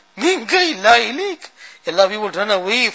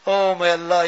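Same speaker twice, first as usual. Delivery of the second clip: ordinary delivery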